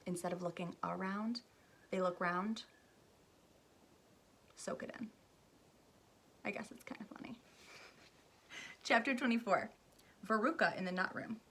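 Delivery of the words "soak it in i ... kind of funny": soft whispered aside. Real, not acted. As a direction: breathe it confidentially. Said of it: "aside whispered to listeners".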